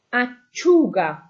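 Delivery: "acciuga" said slowly and loud, ah-CHOO-ga; stress on the u. Shown as a demonstration. Teacher modelling "acciuga" loud and slow, U stressed. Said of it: "your U is where the stress is".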